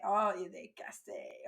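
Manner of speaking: a teacher's half-sighed "Oh, il est cassé", lightly voiced; mild mock disappointment; mock dismay. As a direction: play your own fed-up classroom sigh. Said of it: "I say".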